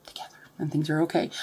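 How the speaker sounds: fast real whisper. Real, not acted. Vlogger at night, dogs nearby, real whisper. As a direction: whisper it quickly, late at night. Late night, quiet recording.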